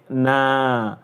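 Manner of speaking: na with a long held a; drawn-out naa; long alif. Shown as a demonstration. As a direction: sustain the vowel in na. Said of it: "this is alif".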